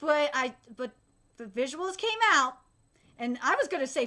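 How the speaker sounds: ordinary delivery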